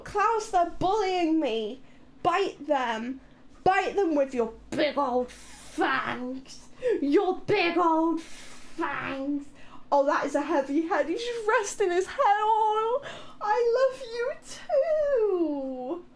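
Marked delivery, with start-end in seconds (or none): 2.2-9.5 cooing to dog. Real, not acted. Streamer coos to her dog about his fangs. 13.4-16.0 slow, high cooing. Real, not acted. Streamer answers her dog, slow and cooing.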